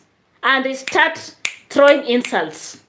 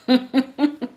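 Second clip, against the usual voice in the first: short amused giggle; light chuckle; real laugh; 4 semitones higher than usual. Real, not acted. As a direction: laugh at the memory of her comment. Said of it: "that is why I'm laughing".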